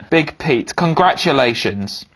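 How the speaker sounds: normal talking voice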